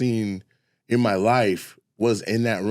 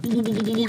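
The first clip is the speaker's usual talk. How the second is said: bird sounds; 9 semitones higher, faster than usual. Retold or imitated. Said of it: "he's making bird sounds".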